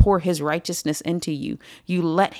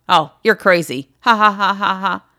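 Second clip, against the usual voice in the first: loud scoff with a fake ha-ha laugh; mock dismissive laughter; 7 dB louder than usual. Preacher as Festus brushing off conviction, mocking forced laughter. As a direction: laugh it off loudly, as if shielding yourself.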